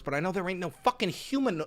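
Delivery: ordinary talk, nothing marked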